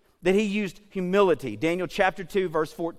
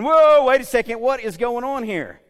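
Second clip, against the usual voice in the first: startled Daniel; 4 semitones higher, 6 dB louder than usual. Acted. Daniel as the guards round everybody up, startled. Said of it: "you can imagine Daniel thinking".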